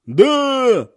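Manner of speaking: high, loud cry; crowd yelling. A narrator's reading. Women in the crowd yell back, high and loud. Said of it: "уже громче заорали тётки".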